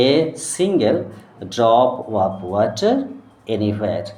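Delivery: slow reading in separated chunks with pauses; broken-up reading. Shown as a demonstration. Teacher breaking a sentence up for a young pupil, word by word. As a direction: read it out word by word. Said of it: "আমি আরও একবার ভেঙে ভেঙে বলবো".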